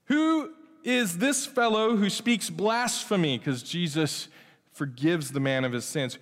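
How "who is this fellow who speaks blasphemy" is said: slow, higher, indignant Pharisee voice; scandalized Pharisee. Acted. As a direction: drag out the outrage of a suspicious scholar. Pharisees' thoughts read from scripture.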